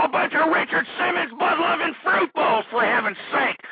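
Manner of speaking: red-faced talk radio host screaming at a liberal caller; ranting scream. Acted. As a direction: lose it completely at the top of your voice. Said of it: "I really shouldn't be screaming".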